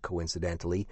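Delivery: plain reading voice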